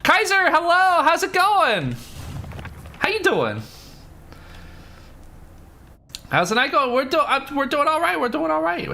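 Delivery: high excited welcome voice; bubbly greeting. Real, not acted. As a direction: greet a friend who just arrived. Greeting a raider warmly.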